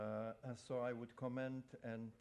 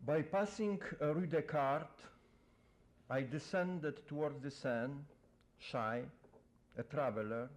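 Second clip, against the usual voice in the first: louder, measured poem-reading voice; poetry reading; 3 semitones higher, 5 dB louder than usual. Acted. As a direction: declaim the poem to the audience. Poet reads his poem aloud.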